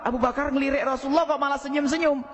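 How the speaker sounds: normal talking voice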